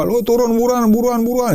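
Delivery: high, urgent panicked call; panicky yell. Retold or imitated. Friend below shouts up in a high panicked call. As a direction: sound like someone in a panic. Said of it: "gitu teriak".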